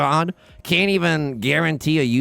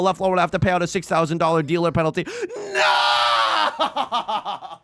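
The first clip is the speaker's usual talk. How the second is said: excited cry; 3 semitones higher than usual. Real, not acted. Gloating over opponent's penalty.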